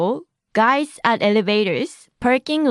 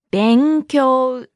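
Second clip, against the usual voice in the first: long, drawn-out syllables; slow model. Shown as a demonstration. Teacher repeats the word meaning study, slowly and deliberately. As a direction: stretch both halves of the word.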